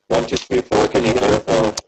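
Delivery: warped, rubbery mic distortion; a mic check through a twangy, faulty line; rubber band mic sound. Real, not acted. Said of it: "you still got a, a rubber band sound".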